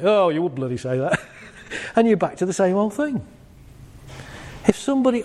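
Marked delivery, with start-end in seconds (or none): 0.0-1.2 lower, sarcastic mock scoff at a forced compliment; scoffing partner. Partner scoffs at the compliment, mock sarcastic. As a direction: sneer it back like a grumpy spouse.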